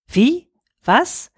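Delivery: short bewildered yelps; baffled. A narrator's reading. Taro hears the riddle, startled and baffled. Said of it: "Taro war verwirrt".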